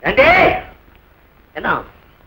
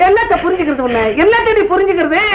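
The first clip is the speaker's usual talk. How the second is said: indignant, rising pitch on the retort; angry retort; 7 semitones higher than usual. Acted. Husband rejecting his wife's plea to be understood, angry retort. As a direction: throw the words back at her angrily. Husband quarrels with wife over suspicion.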